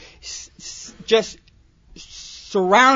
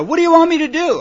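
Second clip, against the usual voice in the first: put-on whiny kid; 10 semitones higher, 5 dB louder, faster than usual. Kid asking sponsor.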